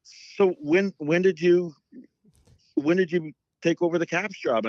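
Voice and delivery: high, bewildered question from the fan; hammed-up high voice of a fan caught out; flustered fan. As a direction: cover the embarrassment with a quick question. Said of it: "He goes".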